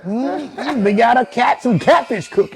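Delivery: high, folksy mock drawl; imitating family back home. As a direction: parody the old-fashioned relatives. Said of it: "everything sounded like".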